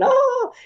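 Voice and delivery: high, loud mock shriek; imitated scream. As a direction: imitate someone shrieking in alarm. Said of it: "go screaming down the street saying".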